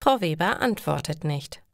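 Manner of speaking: plain reading voice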